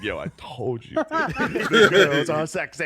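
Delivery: giggling mock bro voice; laughing imitation of Sam. Retelling Sam at the show, laughing. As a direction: quote him and burst into laughter. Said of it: "they show his face like".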